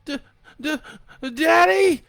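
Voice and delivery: stammering then screaming high and loud; a child shrieking for his father; terrified shout. A narrator's reading. Boy backing away from his mother, screaming for daddy. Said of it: "he shouted".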